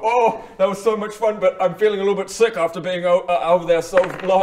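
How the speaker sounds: loud, breathless groaning about feeling sick; queasy showman. Acted. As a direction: ham up the nausea after the act. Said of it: "And then I go".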